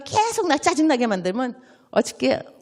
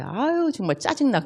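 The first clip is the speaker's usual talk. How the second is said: usual speaking voice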